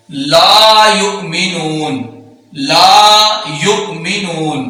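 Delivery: ला drawn out slowly in ला युमिनून; long lam. Shown as a demonstration. Teacher lengthens the lam for students. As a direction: hold the ला long. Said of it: "लाम को लंबा करके पढ़ा जाएगा".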